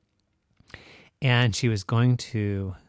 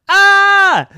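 loud high-pitched scream; mommy's scream; 16 dB louder than usual. Acted. Mommy sees the jack-o'-lantern, screaming. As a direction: shriek out in fright.

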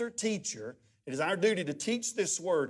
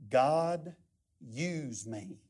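low, halting imitation of a dying man; imitating a sick man's slow plea; slow, labored; 4 semitones lower, 5 dB quieter, much slower than usual. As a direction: spell it out word by word, softly at the end. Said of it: "Say it slow".

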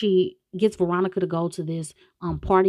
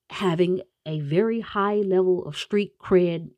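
ordinary delivery

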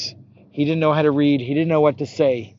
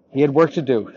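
plain reading voice

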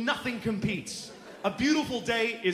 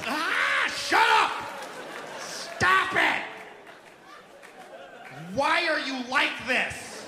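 put-on mother losing it at her kid; parent yelling; 2 semitones higher, slower than usual. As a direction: scream at the kid to stop.

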